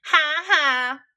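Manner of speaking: bratty giggle; laughing. Acted. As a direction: cackle like a gleeful little sister. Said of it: "Anna laughed".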